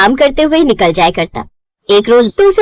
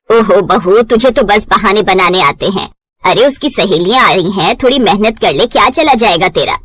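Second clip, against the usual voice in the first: lower, nagging reproach; mother-in-law scolds bride for excuses, lower; scolding mother-in-law; 5 semitones lower than usual. Acted.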